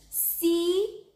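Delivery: mock the bird proudly; defiant taunt. Annie taunts vulture.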